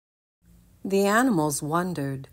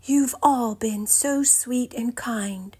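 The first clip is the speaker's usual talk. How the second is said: lightly voiced Buttercup; 7 semitones higher than usual. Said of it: "said Buttercup".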